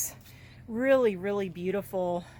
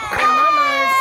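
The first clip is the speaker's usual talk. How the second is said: high, loud squealing delight; shrieking happy news; excited squeal; 10 dB louder than usual. Real, not acted. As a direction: burst out squealing with joy. Excited reveal of new chicks.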